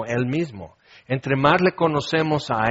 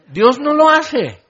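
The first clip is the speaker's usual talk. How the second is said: high, loud and slow declaration; emphatic; 11 semitones higher, 6 dB louder than usual. Acted. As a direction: land the point firmly. Emphatic contrast after baby scene.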